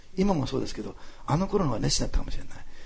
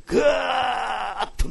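high, very slow stretched mimetic word; drawn-out surge; 10 semitones higher, much slower than usual. Retold or imitated. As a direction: stretch it like feeling welling up. Mimics feeling welling up inside. (こみ上げるように高く長く伸ばして)

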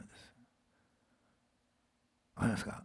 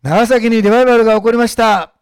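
loud declaration; 5 semitones higher, 13 dB louder than usual. Shown as a demonstration. Pastor leading the congregation in a loud declaration. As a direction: shout it out as a proclamation. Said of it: "いや、雨じゃなくて言ってください。".

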